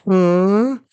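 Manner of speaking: loud, high-pitched startled "huh"; mock shriek of alarm; freaking out. Queen at first sight of her baby, freaking out. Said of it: "she, like, freaks out".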